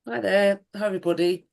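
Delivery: hoarse from a cold; croaky, cold-roughened voice. Real, not acted. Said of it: "excuse my croaky voice".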